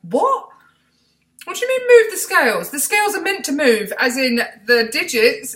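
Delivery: high, loud baffled disbelief; can't believe what he said; incredulous. Retold or imitated. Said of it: "And I was looking at him like".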